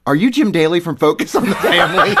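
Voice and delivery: imitated starstruck mom. Young mom recognizing the man holding her baby, mock-excited.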